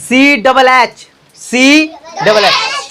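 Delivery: slow teacher spelling, letter by letter. Shown as a demonstration. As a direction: spell सी डबल एच out very slowly, letter by letter. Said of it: "छा कहीं लिखा होगा तो English में".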